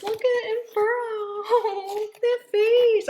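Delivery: high-pitched, squealing adoration; gushing. Real, not acted. Unboxer melting over an adopted gorilla, gushing. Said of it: "or I'll keep gushing".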